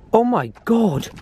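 drawn out in awe; amazed. Real, not acted. Excited at the bottle find.

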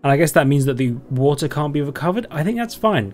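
normal talking voice

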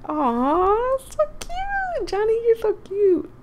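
high cooing gush at Johnny's romance; gushing Kat. Acted. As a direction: coo over Johnny like a smitten friend. Voicing Kat gushing over Johnny.

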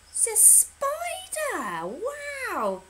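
high, drawn-out delighted announcement; excited reveal. Pretend. Presenter tells children the favourite bug, excited reveal. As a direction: reveal it with big wide-eyed wonder.

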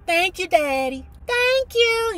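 high thrilled thank-you; shrieked thanks. Retold or imitated. Girl thanking her father for the skates, high shriek. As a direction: squeal your thanks. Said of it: "I shrieked".

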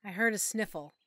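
ordinary delivery

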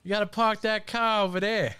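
put-on playful broad Boston accent. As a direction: drop the Rs like a Bostonian. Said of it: "that accent".

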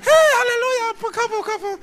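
very high, loud whooping; hammed-up high celebration; mock wedding cheer. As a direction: squeal it like an overexcited guest. Acts out wedding guest cheering.

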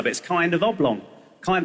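ordinary talk, nothing marked

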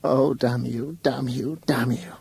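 tearful curse. A narrator's reading. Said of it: "tears began to roll".

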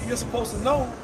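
usual speaking voice

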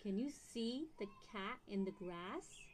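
usual speaking voice

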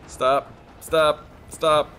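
loud rising yells of stop; yelling stop. Real, not acted. Player yelling stop at the chasing monster, loud.

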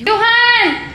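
loud high call across the house. Real, not acted. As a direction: yell a name to someone far off. Calling kids during brownout.

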